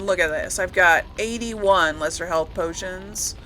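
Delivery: ordinary delivery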